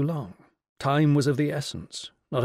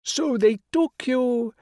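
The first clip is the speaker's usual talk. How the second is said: grand regal lady, pitched high and louder; rich royal voice; 12 semitones higher, 4 dB louder, slower than usual. Acted. The Rani greeting Will on the phone, rich and regal. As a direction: sound like a queen condescending down the phone. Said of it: "the rich royal voice".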